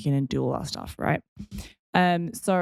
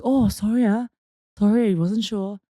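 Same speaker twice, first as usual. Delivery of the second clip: put-on apologetic uncle in a Malaysian accent, pitched up; 5 semitones higher, 5 dB louder than usual. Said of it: "I'm just doing a Malaysian accent".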